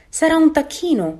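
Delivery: high, loud worried exclamation. A narrator's reading. Mother duck seeing the big grey duckling, worried. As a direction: blurt it out in lightly acted alarm. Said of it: "si preoccupò l'anatra".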